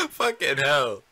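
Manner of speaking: gasped out through laughter; laughing. Real, not acted. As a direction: swear while still cracking up. Still laughing at the clip.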